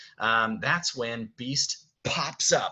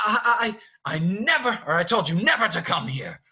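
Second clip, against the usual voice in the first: loud, high, sputtering anger; acted angry scolding; 9 semitones higher, 7 dB louder than usual. Beast stomps after Belle down the stairs, loud angry scolding. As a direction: stammer into a furious rebuke.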